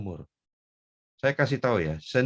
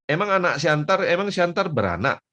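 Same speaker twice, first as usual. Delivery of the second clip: high, loud incredulous question; challenging retort; 9 semitones higher, 7 dB louder than usual. Retold or imitated. Friend countering the preacher's claim, loudly incredulous. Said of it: "dia bilang".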